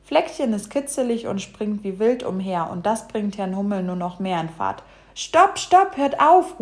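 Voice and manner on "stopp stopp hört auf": high, loud cry to stop; alarmed, high-pitched storybook call. A narrator's reading. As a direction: shout urgently to break up a fight. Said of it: "ruft Bruno".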